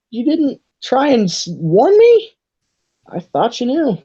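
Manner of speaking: high-pitched, hurt-sounding protest; disappointed. Acted. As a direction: plead in a high, let-down voice. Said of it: "looks disappointed".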